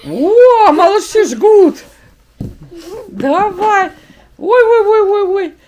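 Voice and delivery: loud, high delighted cheer; excited cheering. Real, not acted. Kids' push-up race, cheering.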